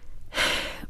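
weary sigh; long tired sigh. A narrator's reading. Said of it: "stretched back in his chair and sighed".

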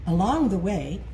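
ordinary delivery

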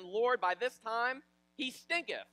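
loud, high, drawn-out objection; hammed-up protest. Martha objects to moving the stone, hammed-up protest. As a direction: protest it slowly with comic weight.

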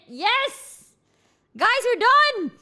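high loud triumphant shout; excited cheer. Real, not acted. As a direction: celebrate finishing at last. Celebrating finished setup.

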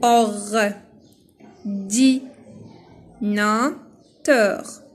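"or-di-na-teur" very slowly, one syllable at a time; split into syllables. Shown as a demonstration. Teacher cutting a word up for pupils, "or-di-na-teur" syllable by syllable. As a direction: break "or-di-na-teur" into four drawn-out beats with long pauses. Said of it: "La première syllabe".

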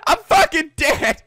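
high-pitched comic wail of defeat; worked-up protest. Pretend. Player tells Mifa he is dead, high worked-up protest. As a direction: whine it high, as if beaten for good. Protesting after dying in the game.

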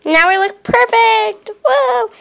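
high-pitched, showy exclamation; showy delight. Pretend. Vlogger shows off finished makeup, high showy delight. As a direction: exclaim it way up high, admiring yourself.

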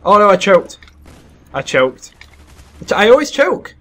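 loud, high-pitched exasperation; dismayed self-reproach; frustrated outcry. Real, not acted. Frustrated at missing the kills.

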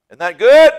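loud, high call to the crowd; rousing shout. Real, not acted. Preacher asking his church, loud rousing shout. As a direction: fire up the congregation.

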